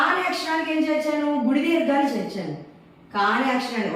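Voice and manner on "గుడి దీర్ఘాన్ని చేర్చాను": usual speaking voice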